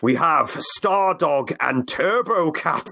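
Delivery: slow, booming movie trailer voice; epic announcer voice. Pretend. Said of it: "in an epic announcer voice".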